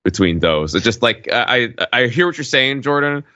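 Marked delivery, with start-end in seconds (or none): none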